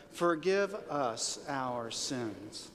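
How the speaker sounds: very slow, deliberate say-along; say-along recital. Shown as a demonstration. Preacher has the congregation say it to believe it, drawn-out recital. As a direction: stretch each word of FORGIVE US OUR SINS. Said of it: "let's say it because we gotta believe it".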